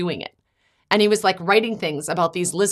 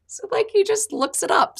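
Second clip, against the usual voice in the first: laughing; 13 semitones higher than usual. Real, not acted. Laughs at son's answer.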